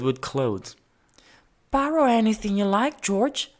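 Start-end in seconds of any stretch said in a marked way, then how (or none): 1.7-3.5 higher lady voice, quick and eager; Mrs Gray's voice. A narrator's reading. Mrs Gray urging George to borrow clothes, quick and high. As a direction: rush the offer out brightly. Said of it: "she said".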